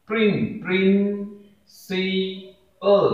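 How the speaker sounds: प्रिंसिपल very slow and high, प्रिं… सि… पल; in three parts. Shown as a demonstration. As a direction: restart on प्रिं, then stretch each part of प्रिंसिपल. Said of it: "इसे तीन parts में बोलते हैं".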